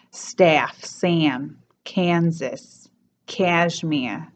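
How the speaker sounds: Staff, Sam, Kansas, cashmere with the A gliding into a diphthong; New York accent, stretch the A in Staff; New York short A. Shown as a demonstration. Teacher demos the New York short A diphthong. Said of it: "pronounced more like a ah".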